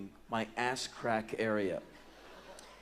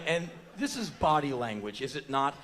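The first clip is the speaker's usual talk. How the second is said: ordinary delivery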